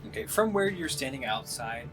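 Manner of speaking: ordinary talk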